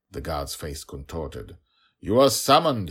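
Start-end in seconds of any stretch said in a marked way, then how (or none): 2.0-2.9 higher, louder insistent summons; guard, higher voice. Acted. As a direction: repeat it, pitch climbing with irritation. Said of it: "he repeated in a higher voice".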